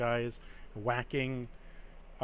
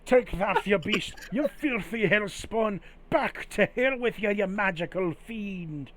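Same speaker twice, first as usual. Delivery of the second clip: zealous villager; 6 semitones higher than usual. Acted. Townsman yells at the supposed hell spawn, high hammy fury. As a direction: rail at the monster like a hammy witch-hunter. GM voices townsfolk attacking monster.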